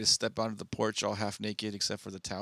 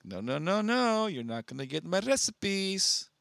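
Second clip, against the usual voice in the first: higher, slow, teasing no; guard the secret recipe playfully; dragged-out mock refusal, pitched up; mock protective refusal; 9 semitones higher than usual. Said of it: "I'm like".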